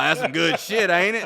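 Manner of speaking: mock gloating through laughter; laughing friend. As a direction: cackle it out like a prankster proud of himself. Friend gloating after crack trick.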